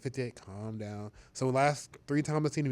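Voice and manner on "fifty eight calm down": mock calm Rodgers. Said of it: "He's like".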